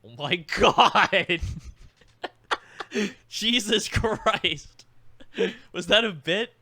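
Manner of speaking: loud, high, slow laughing outburst of shock; stunned laughing cry at a brim-full glass; shocked laughing exclaim. Real, not acted. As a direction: blurt it out half laughing, real surprise. Shocked by overfilled shot glass.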